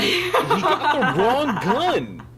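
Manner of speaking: high, slow laughing cry; laughing exclamation. Real, not acted. Laughing outburst.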